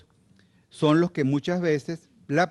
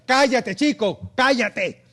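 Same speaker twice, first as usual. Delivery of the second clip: high, slow, drawn-out yell; yelling at kids; 10 semitones higher, 4 dB louder, much slower than usual. Retold or imitated. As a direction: shout to hush children in a park. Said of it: "están los muchachos en el parque". (grito lento y agudo mandando a callar)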